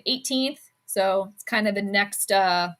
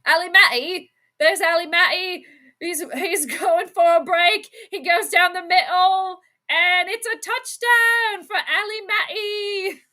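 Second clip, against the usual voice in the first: excited sportscaster call, higher and louder; mock play-by-play call; 7 semitones higher, 3 dB louder than usual. Sportscaster calling a touchdown for Allie Maddie. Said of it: "Just sounds like you wanna say that British".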